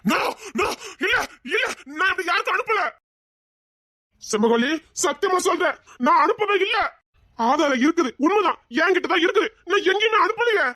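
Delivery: panicked, swearing he is innocent; near-tearful cries of denial; frantic denial. Acted. He frantically denies sending photos.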